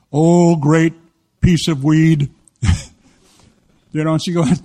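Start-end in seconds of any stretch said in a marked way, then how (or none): 0.1-2.7 loud, drawn-out mock invocation; mock worship. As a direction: lead a mock prayer, loud and solemn. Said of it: "Now say this with me".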